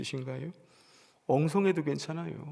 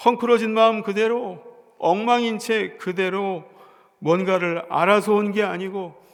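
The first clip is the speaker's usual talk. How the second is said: loud, high impassioned sermon delivery; pastor's ringing pulpit voice; fervent preaching; 5 semitones higher, 8 dB louder than usual. Acted. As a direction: preach it out with full conviction to the congregation. Pastor preaching at full force.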